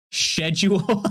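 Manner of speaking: mock-British "Schedule" starting with SH; British pronunciation.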